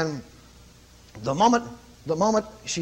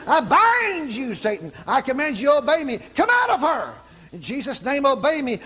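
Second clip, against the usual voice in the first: commanding rebuke; 7 semitones higher than usual. Retold or imitated. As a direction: order the devil out with full authority. Retold casting out a spirit.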